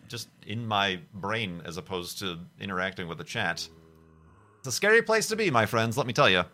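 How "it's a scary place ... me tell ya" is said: loud, high playful warning; playful exclaim. Real, not acted. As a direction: ham up the scary place. Jokey outro remark.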